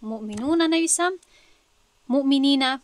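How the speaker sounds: loud مؤمنین against the earlier مؤمنون; contrasting forms. Shown as a demonstration. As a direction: hit مؤمنین hard to show the new ending. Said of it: "من به جایی که".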